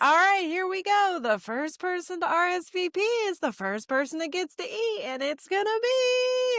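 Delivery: high, dramatic hype-man build-up; dramatic DJ announcement. Retold or imitated. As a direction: hype the crowd like a wedding DJ. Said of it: "make it real dramatic".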